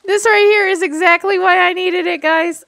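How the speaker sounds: loud, high excited burst; thrilled exclamation. Real, not acted. Excited outburst while fishing.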